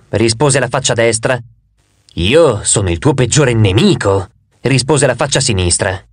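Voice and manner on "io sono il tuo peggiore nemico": lower, slow hostile face; the two-faced god's sinister side, low and slow. Acted. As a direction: play the enemy face, pitched down and drawn out. Two faces, friend versus enemy.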